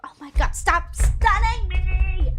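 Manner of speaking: loud, slow exasperated yell; frustrated yell. Real, not acted. Streamer yelling at a stunning enemy, loud.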